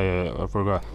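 ordinary talk, nothing marked

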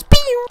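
sudden very high squeak; squeaky pew sound. Retold or imitated. Gamer mimicking flying buckets, high squeaky pew. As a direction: zap the word out like a cartoon.

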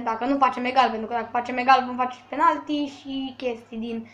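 ordinary talk

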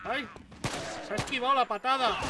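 loud, fast alarmed cries; panicked shout. Real, not acted. Gamer dodging a kick, loud fast panicked cries. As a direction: yelp in panic mid-fight.

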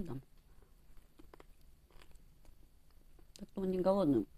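talking while eating wing; biting meat off bone; mouth full, chewing. Real, not acted. Seen 0: Bites and chews wing throughout; cheeks full, wing at lips.

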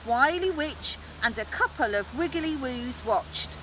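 ordinary delivery, nothing marked

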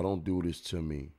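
ordinary talk, nothing marked

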